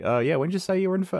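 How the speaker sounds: high, fast imitation of the guy on the phone; mimicking a hurried voice on the phone; mock high quick voice. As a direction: toss it off quickly and high, like a distracted caller. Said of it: "and he was like".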